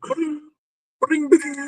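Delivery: high sing-song ba-ding; imitated bike bell. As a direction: ring it like a little bell, high. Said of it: "It's like".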